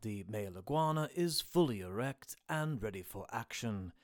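low, hushed wildlife narration; nature documentary narrator. Acted.

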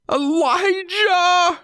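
very high, drawn-out wail of a name; anguished cry. A narrator's reading. Kidnapped wife calling out for her husband, anguished. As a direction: stretch the name into a slow, high cry for help. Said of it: "Her sobs were heart-wrenching".

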